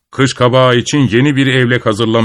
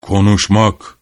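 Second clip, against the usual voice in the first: bear grumble; 4 semitones lower, slower than usual. A narrator's reading. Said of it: "Ayı homurdandı".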